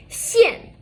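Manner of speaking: "Xian" as one syllable, pitched high then falling sharply; falling-tone "Xian", demonstrated for learners; fourth tone. Shown as a demonstration. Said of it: "This is the fourth tone here".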